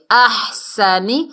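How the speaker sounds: احسنی with a firm س, drawn out; slow recitation. Shown as a demonstration. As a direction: recite احسنی slowly, hitting the س. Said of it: "سین کو سختی سے". (احسنی سختی سے)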